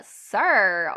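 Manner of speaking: usual speaking voice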